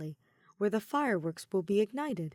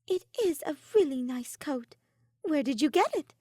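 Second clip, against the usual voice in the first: higher, lighter voice for Ophelia; curious young mage, a bit louder; Ophelia's voice; 7 semitones higher, 5 dB louder than usual. A narrator's reading. Ophelia admiring Soleil's coat, higher and curious.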